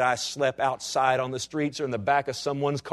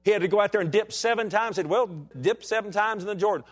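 higher, louder preacher's cry; full-force preaching; 9 semitones higher, 4 dB louder than usual. Acted. Pastor exhorting his congregation, in full-force preaching. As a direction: preach it out to the church, rising.

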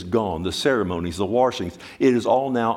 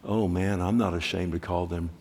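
tender quiet voice; 4 semitones lower, 6 dB quieter than usual. Retold or imitated. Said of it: "he said".

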